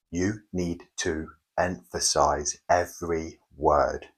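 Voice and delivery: slow, leaning on each word; spaced out, each word given weight; slow, every word stressed. Shown as a demonstration. Coach demonstrating the method to viewers, slow and stressed. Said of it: "like that".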